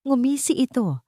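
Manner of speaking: ordinary delivery, nothing marked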